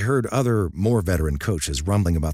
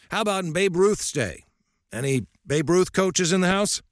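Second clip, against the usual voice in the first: put-on lightly raised, gruff old coach lecturing; old coach's voice; 11 semitones higher than usual. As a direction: speak as a stern old baseball coach.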